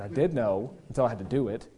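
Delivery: a low, soft answer through a laugh; laughing excuse. Retold or imitated. Son excusing himself to dad, laughing softly. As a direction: own up with a chuckle. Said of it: "I said".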